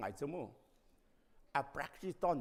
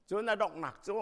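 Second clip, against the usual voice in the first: higher and louder, pressed hard; a forceful classroom demo of จนอดอกหนักโจ; emphatic demo; 6 semitones higher, 5 dB louder than usual. Shown as a demonstration. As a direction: drive the phrase จนอดอกหนักโจ out loud and high. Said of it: "stress มุติกาจนรอจงไอ้ดุสลาวโจ".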